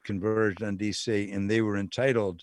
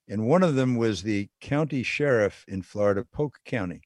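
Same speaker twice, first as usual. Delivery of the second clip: usual speaking voice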